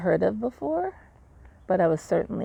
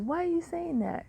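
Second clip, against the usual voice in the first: nurse's alarmed question; 11 semitones higher than usual. Retold or imitated.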